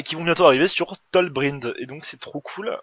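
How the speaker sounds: normal talking voice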